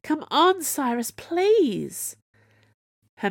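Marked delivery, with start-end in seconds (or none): 0.0-2.2 high, louder begging with a drawn-out last word; frantic plea. A narrator's reading. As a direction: plead desperately with a brother. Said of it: "frantic now".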